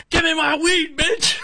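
high, shouted demand with a cry; mock scream. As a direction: scream at her for the weed. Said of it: "like, screaming at her".

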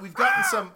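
sudden very high eagle screech; shrieking giant bird. Pretend. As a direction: screech like a huge bird of prey. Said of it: "just devolves into just screeching".

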